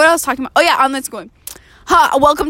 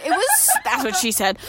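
ordinary talk, nothing marked

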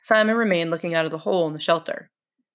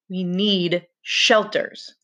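"We need shelters" said very slowly; dragged out; much slower than usual. Acted. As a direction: stretch every word, stubbornly. Stubborn reply in quarrel.

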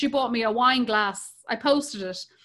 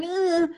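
1 mock groan; 6 semitones higher than usual. Everyone groaning about homeschooling.

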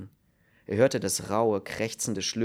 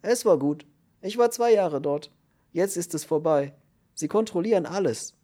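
higher, louder voice of a worked-up prisoner; agitated new cellmate; 8 semitones higher, 5 dB louder than usual. A narrator's reading. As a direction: complain in a high, agitated voice. (aufgeregter Häftling, hohe Stimme)

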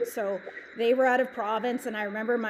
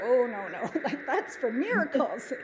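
laughing. Retold or imitated. Laughing at her own old refusal.

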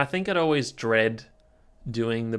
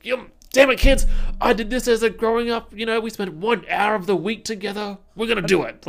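high, loud put-on parent voice; strict parent voice; 8 semitones higher, 5 dB louder than usual. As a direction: lay down the law to the kids.

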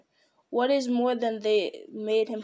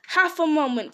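louder, higher sudden cry; quick raised exclamation; 5 semitones higher, 5 dB louder than usual. A narrator's reading. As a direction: call out to stop him. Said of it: "cried Bilbo".

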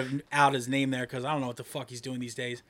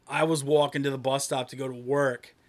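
ordinary talk

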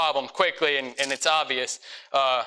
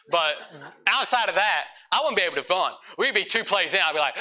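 usual speaking voice

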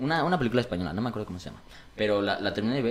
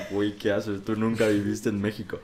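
light mock chilango accent; a hint of Mexico City slang voice; teasing chilango imitation; 4 semitones lower, 4 dB quieter than usual. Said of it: "empieza a hablar como chilangos".

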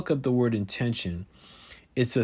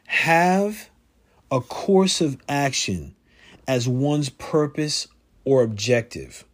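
ordinary talk

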